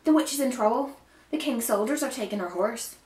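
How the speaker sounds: high, loud alarm; urgent storybook call. A narrator's reading. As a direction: raise the alarm to the village. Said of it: "she called out".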